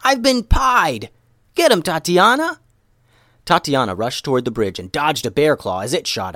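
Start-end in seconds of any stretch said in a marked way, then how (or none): 0.0-2.6 high, loud, drawn-out cry; outraged yell. A narrator's reading. Pied ninja yells to her partner for help. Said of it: "she yelled".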